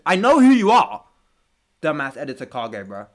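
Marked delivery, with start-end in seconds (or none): 0.1-1.1 loud, high-pitched outburst; sudden high, loud call-out; raised voice. Real, not acted. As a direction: shout it at the editor. Mock warning to the editor.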